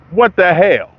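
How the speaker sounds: exasperated. Real, not acted. Disbelief at tour schedule.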